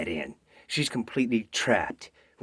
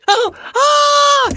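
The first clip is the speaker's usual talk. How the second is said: terrified scream; 18 dB louder than usual. Acted. Girl sees the wolf.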